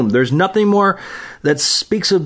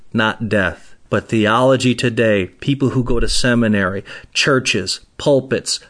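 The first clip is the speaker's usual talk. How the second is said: normal talking voice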